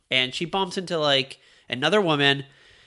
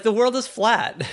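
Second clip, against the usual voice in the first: higher, hammed-up peasant certainty; mock medieval villager; 8 semitones higher than usual. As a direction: play a clueless old-world villager, pitched up. Villagers voiced, laughter follows.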